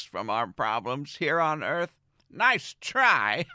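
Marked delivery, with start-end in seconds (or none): 2.3-3.5 sarcastic jab at the moon, higher and louder; smug little put-down; mocking. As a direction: tease it like a rival. Mock address to the moon.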